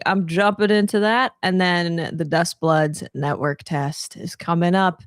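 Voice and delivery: ordinary talk, nothing marked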